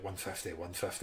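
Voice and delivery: low, quiet thought to himself; inner thought. Retold or imitated. Athlete recalling the distance in his mind, softly to himself. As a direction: murmur it softly like a private mantra. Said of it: "in the back of my mind".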